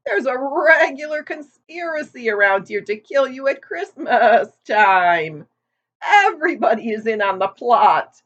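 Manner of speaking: put-on a gossipy old sheep leaning in. A narrator's reading.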